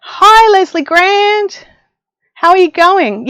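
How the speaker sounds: high, slow, cry-like hello; greeting a viewer with a squeal; excited greeting. Real, not acted. Streamer greeting a viewer by name, squealing excitedly.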